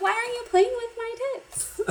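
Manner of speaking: put-on high, squeaky girl's voice, laughing through it. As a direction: squeal it like a flustered anime girl, cracking up. Girl character quoted, laughing.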